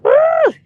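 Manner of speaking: loud high "woo"; piercing, short woo; whoop. Real, not acted. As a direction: let out a big whoop. Whoops after laughing.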